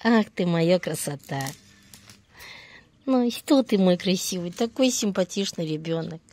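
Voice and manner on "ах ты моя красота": high cooing pet talk; squeaky praising a dog. Real, not acted. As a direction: coo happily at the dog.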